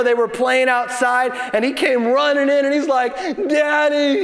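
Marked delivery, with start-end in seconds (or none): 3.5-4.2 mock wail of a little boy; drawn-out, whiny cry for Dad; crying child. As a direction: imitate a small son crying to his father. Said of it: "and he's crying".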